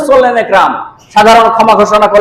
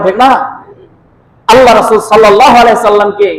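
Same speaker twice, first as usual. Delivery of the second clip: ordinary talk, nothing marked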